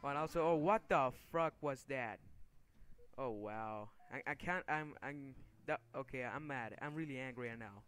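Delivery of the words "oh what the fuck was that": startled outburst. Real, not acted. Gamer reacting to a sudden in-game death, startled outburst. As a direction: blurt out real disbelief, louder.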